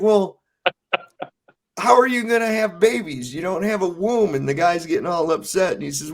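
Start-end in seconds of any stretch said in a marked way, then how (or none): 0.0-4.3 higher, laughing incredulous question; laughing mock disbelief. Retelling Stan's skit, laughing and higher. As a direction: laugh through the objection. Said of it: "And he's like".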